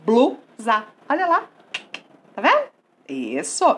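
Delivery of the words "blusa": BLU, pause, SA; teacher splitting Blusa into two separate syllables; divided into syllables. Shown as a demonstration. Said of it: "Vamos dividir?".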